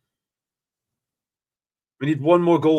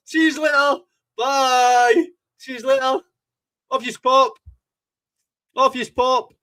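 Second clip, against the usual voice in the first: much higher sing-song taunt; mock farewell; 9 semitones higher, slower than usual. Fan waving off the leaving rival supporters, teasing. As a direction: wave them off in a teasing high voice.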